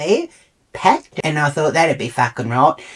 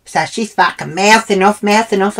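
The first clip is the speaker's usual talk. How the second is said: ordinary talk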